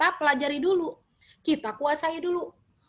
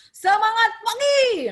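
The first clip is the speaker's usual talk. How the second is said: loud, high-pitched rallying morning greeting; enthusiastic; 9 semitones higher, 7 dB louder than usual. Shown as a demonstration. Trainer opening a session for the audience, enthusiastic shout. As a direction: fire up the room with big energy. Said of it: "antusias gitu ya".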